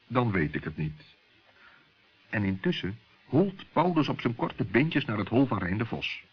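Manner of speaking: usual speaking voice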